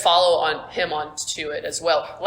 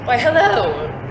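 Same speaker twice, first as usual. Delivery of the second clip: high, loud put-on hello; captor's greeting; 5 semitones higher, 5 dB louder, slower than usual. Captor greets prisoner at sword point, theatrically polite. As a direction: greet your captive with mock courtesy. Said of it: "He goes".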